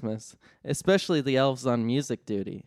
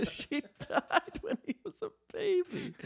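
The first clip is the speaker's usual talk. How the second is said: high giggly breaking-up voice; can barely get it out for laughing; 11 semitones higher, 5 dB quieter than usual. Real, not acted. Storyteller cracking up explaining the dead mother.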